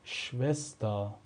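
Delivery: شوستا slow, shvestaa; German Schwester demo. Shown as a demonstration. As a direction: model شوستا syllable by syllable.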